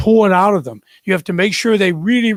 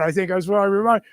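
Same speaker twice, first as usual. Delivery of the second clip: normal talking voice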